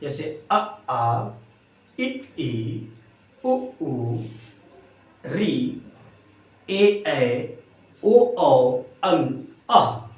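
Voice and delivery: अ, आ, इ, ई recited slowly with pauses; vowel chart read out one sound at a time; reciting the vowels. Shown as a demonstration. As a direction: chant the vowel list like a schoolchild. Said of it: "आपने बारह खड़ी पड़ी होगी बचपन में".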